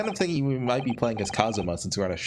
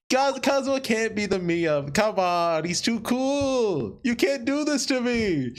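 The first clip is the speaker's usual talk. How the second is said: high, drawn-out mock wail; hammed-up dismayed whine; dismayed protest; 8 semitones higher, slower than usual. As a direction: protest the news with pitch way up. Player protesting a favourite character's death.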